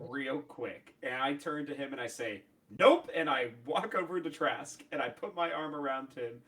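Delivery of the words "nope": loud, high-pitched cut-in; firm refusal. Acted. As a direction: shut them down with a sudden loud burst. Player acts out cutting Trask off.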